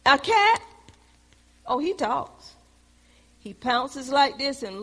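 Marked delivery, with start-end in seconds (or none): none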